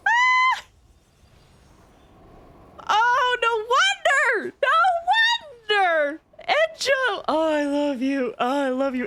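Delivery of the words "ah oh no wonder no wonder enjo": excited. Real, not acted. Still overjoyed at character.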